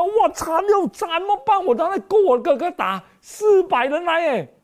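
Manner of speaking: high, loud frantic wail; panicked cry. Acted. Jacob crying out in terror as his brother marches near. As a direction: cry out like a man who hears his doom coming. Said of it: "叫：".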